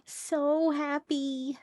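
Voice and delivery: high, slow and drawn-out delight; gushing happiness. Real, not acted. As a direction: stretch it out in a soft, high gush. Gushing over friend's stream.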